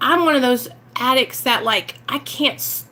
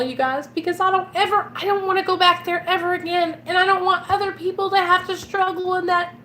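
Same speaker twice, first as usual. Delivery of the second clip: getting emotional; 6 semitones higher than usual. Real, not acted. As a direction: pitch rising higher as the feeling wells up. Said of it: "I'm gonna get emotional".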